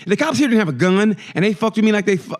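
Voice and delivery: ordinary talk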